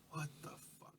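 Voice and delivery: whispered, no voice at all; whispered what the fuck. Acted. Bikers whispering in confusion, whispered. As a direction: hiss it in confusion. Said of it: "they're already like".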